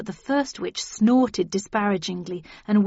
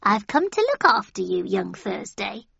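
put-on old lady voice. A narrator's reading. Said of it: "she announced".

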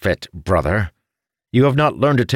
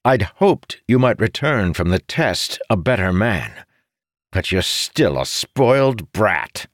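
higher, forceful scolding; harsh; 8 semitones higher, 4 dB louder than usual. A narrator's reading. Brother scolds his spoiled sibling, harsh. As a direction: dress your brother down harshly. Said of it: "Arentil said harshly".

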